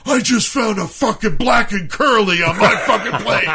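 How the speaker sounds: high-pitched, over-the-top complaint; outraged mock scream. Friend complaining about his plate, mock screaming. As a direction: act out a diner's theatrical fit. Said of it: "screaming at the top of his fucking lungs".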